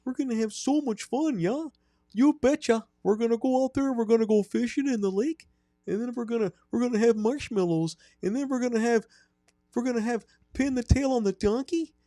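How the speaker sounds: put-on very high-pitched Wisconsin accent, cheery and creepy; sing-song high camp instructor in a Wisconsin lilt; creepy camp counselor voice. Host playing a creepy camp instructor to kids. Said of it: "Sell it".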